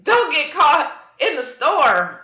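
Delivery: loud high yell of run; shouted warning. Retold or imitated. Calling out to strangers to flee the dust storm, loud and urgent. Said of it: "I'll be like".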